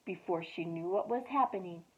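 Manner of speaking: usual speaking voice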